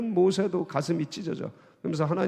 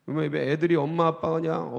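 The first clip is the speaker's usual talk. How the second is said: ordinary talk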